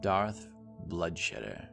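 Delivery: ordinary delivery, nothing marked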